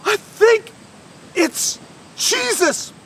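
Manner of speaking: high, halting, fearful voice; terrified. Acted. Fisherman telling his friends what he sees, terrified. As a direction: force it out slowly in fear, pitched high. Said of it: "he was absolutely terrified".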